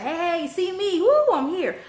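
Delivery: high, loud attention-seeking holler; mock noisy show-off. Resident mimicking ratchet noisemakers, mock rowdy. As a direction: whoop it up to be noticed, mocking. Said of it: "making noise like".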